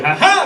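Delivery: high triumphant mock laugh; ringleader cackle. Ringleader taunting the cops, a high cackle. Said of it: "You're like".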